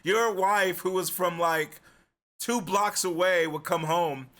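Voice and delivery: usual speaking voice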